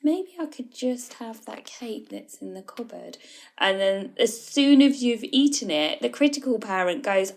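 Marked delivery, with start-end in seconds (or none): 0.0-3.2 higher, soft, wheedling little voice; child ego voice. Acted. Child ego persuading itself to eat cake, soft and higher. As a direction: talk yourself into the cake like a hopeful child. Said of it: "the child ego will be like".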